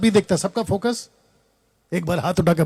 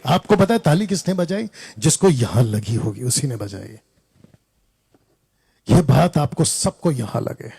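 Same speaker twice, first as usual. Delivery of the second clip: ordinary talk, nothing marked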